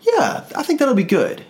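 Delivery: high eager voice. Retold or imitated. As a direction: answer eagerly, voice way up. His past self answering.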